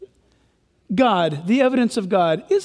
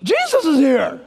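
giddy Herod; 12 semitones higher, slower than usual. Retold or imitated. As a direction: whoop like an overexcited king at a show. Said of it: "He's like".

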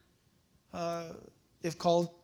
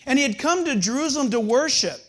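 scripture reading; 3 semitones higher, 6 dB louder than usual. Acted. Reading Acts aloud.

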